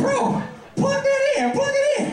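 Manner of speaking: put-on excited kid brother. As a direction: yell like an eager little kid. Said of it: "It was like".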